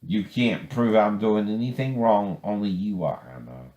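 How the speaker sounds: ordinary talk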